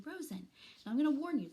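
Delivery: ordinary delivery, nothing marked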